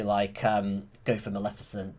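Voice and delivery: plain reading voice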